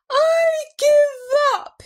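slow, high, loud wail; blubbering. A narrator's reading. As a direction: wail in total surrender. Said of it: "he blubbered".

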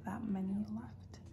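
soft whisper in a quiet room; library whisper. Real, not acted. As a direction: whisper so nobody nearby hears. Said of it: "Whispering 'cause I know people can hear me".

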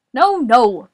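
loud, exasperated shout; Boris. A narrator's reading. Boris correcting Morris over a cough drop, shouting. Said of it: "Boris shouted".

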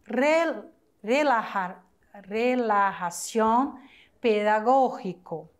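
each word read slowly with pauses between; slow, careful teacher reading of a word list. Shown as a demonstration. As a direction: spell out every word slowly for the class. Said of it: "a opção que classifica corretamente as palavras".

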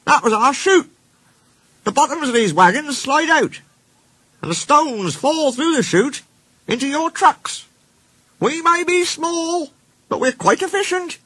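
very high little-engine character voice; small engine Rex, proud. Acted.

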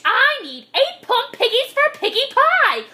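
high, threatening rant; screaming witch. A narrator's reading. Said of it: "screamed Gritch".

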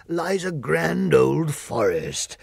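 slow grand. Acted. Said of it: "Lion slow grand".

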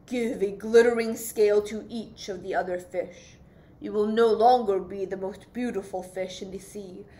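low, measured wise-creature voice; deep octopus voice. Acted. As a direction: counsel him gravely from the dark. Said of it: "said the octopus with a deep voice".

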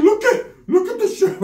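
high-pitched, laughing brag; high laughing "look at this"; high laughing show-off. Retold or imitated. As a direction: laugh it out proudly. Primo showing hickeys, laughing.

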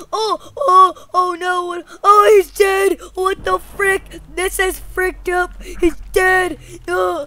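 high, loud, horrified outburst; hammed-up distraught kid; mock panic. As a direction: panic loudly over what you did. Boy panics over a death.

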